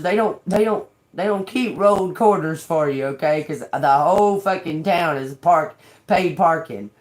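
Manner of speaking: normal talking voice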